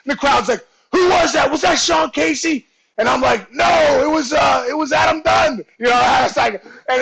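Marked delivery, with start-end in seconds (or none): 0.9-2.7 higher mock shout of a crowd; pitched-up retold holler; crowd calling out. 3.5-5.6 higher, mock-innocent holler back; pitched-up retold shout; shouted denial.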